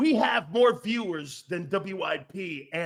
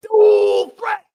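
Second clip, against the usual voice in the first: much higher and much louder, slow; hyped shout; 12 semitones higher, 11 dB louder than usual. Real, not acted. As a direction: blast it like a highlight call. Hyping the quarterback again.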